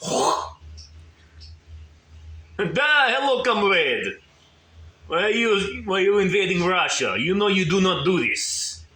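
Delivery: put-on lightly Russian-accented comrade. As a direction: greet him like a Russian comrade. Voicing a Russian soldier.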